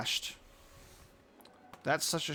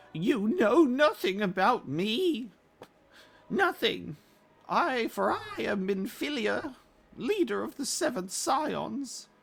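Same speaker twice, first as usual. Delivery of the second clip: high, slow, dignified heroine voice; voicing Minfilia; 11 semitones higher, 7 dB louder, slower than usual. Streamer reading Minfilia's game lines in a high, slow voice. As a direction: speak as a proud leader being defied. Reads game dialogue aloud.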